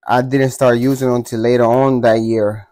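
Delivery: ordinary talk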